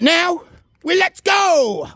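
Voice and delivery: high, slow rallying shout; hype shout. Real, not acted. Vlogger back walking after illness, triumphant shout. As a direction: burst out with triumph.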